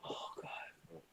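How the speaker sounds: breathy whispered sigh; whispering. Real, not acted.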